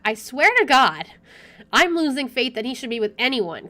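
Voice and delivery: ordinary talk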